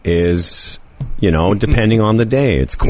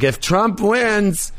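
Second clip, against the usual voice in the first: mock American threat; 9 semitones higher than usual. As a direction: put on a high-pitched complaining American. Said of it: "one of those Americans who are like".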